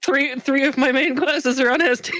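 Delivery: high-pitched gleeful squeal; gleeful, voice shooting way up high; giddy delight. Real, not acted. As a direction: squeal it, about to burst out laughing. Giddy at own favorites ranking top.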